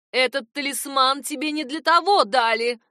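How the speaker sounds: громко и гневно; отчитай его громко; сердито. Acted. Мать ругает сына. (громкий гнев матери)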